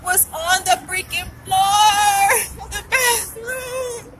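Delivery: high, slow, crying whine; near tears. Real, not acted. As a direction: let the voice crack into tears. Despair over lost data.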